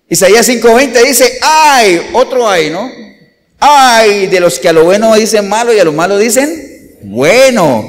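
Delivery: loud, slow pulpit reading of the woes; thundering sermon reading; preaching at full force. Acted. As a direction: proclaim it to the whole church, slowly. Reads scripture at full force.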